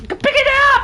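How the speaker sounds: high, loud cry of frustration; frantic yell. Real, not acted. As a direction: yell the order in exasperation. Player urging game character.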